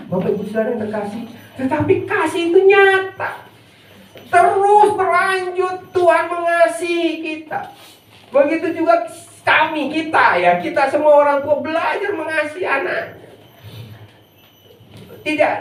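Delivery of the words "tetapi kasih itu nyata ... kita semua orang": high, drawn-out preacher's proclamation; emphatic preaching. Real, not acted. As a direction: proclaim it to the congregation, high and stretched. Preaches with rising force.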